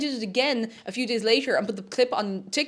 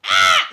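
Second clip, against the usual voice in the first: loud, much lower scream; Tom scream impression; 12 dB louder than usual. Shown as a demonstration. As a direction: roar it low from the throat. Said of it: "it's a little too tonal".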